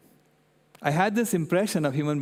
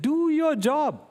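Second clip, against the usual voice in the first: put-on much higher and louder, stern little girl; scolding child; 12 semitones higher, 4 dB louder, much slower than usual. Granddaughter scolding grandpa with a finger, in a hammed-up stern child voice. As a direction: wag your finger and order it, hammed-up. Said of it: "shook her finger at me and said".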